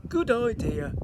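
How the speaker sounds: a lightly voiced skeleton agreeing; big skeleton. A narrator's reading.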